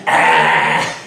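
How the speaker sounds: loud, rough yell; mock scream. Narrator imitating himself chasing the image, loud yell. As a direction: yell it out like a terrified rider. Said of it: "And I'm going".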